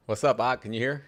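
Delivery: usual speaking voice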